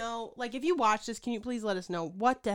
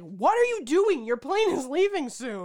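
mock exasperated aunt; 5 semitones higher, 8 dB louder than usual. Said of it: "Jules is like".

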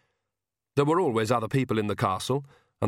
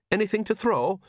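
Jason voice; 9 semitones higher than usual. Acted. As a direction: raise the pitch for a simple big son.

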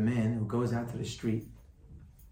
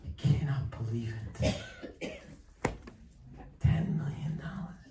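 slow, whispered disbelief; stunned whisper; 4 dB quieter, slower than usual. A narrator's reading. Rabbi voices the stunned man, whispering. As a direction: let the shock sink in softly.

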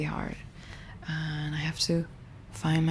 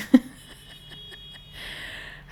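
high-pitched, drawn-out laughter; real laugh. Real, not acted. Speaker recalling cousin laughing through his tears, high helpless laugh. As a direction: crack up while remembering.

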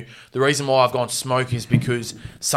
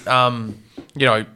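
ordinary talk

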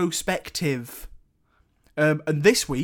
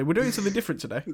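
quick, rattled-off catchphrase; mocking his own usual opener, fast; mock intro line; 4 semitones higher, 3 dB quieter, much faster than usual. To the audience, parodying his weekly opener, rattled off fast.

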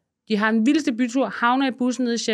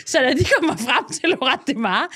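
ordinary talk, nothing marked

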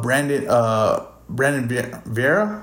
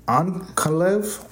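put-on slow, drawn-out Russian accent on the name; 5 semitones higher, much slower than usual. Host announcing a fighter's name in a mock Russian accent. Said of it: "like, a Russian accent when I do this".

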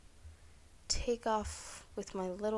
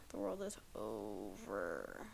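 much lower, slow and dark, mock tragic; doom-laden repeat; 11 semitones lower, 9 dB quieter, slower than usual. Shown as a demonstration. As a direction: drop it low like the end of the world. Repeated for dramatic effect.

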